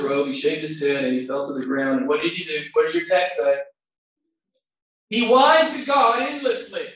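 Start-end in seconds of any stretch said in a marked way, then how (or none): 5.1-6.9 loud, higher sarcastic shout; sarcastic raised shout; mock wrong answer. Shown as a demonstration. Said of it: "he's gonna whine a little bit later".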